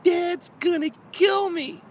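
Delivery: high, slow panicky wail; panicked kid. Retold or imitated. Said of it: "I'm like".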